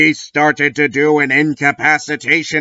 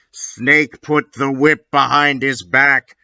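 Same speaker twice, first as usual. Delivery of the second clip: ordinary delivery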